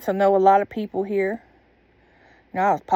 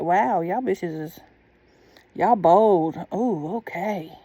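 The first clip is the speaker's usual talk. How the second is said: mock-impressed drawl; much slower than usual. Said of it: "I'm like".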